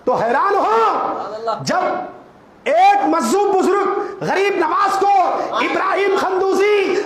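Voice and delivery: fervent pulpit cry; preaching at full force. Acted. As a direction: call it out to the whole congregation. Preacher rises again.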